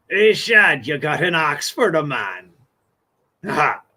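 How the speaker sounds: lightly voiced growly-free hammed pirate. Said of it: "they didn't talk like that".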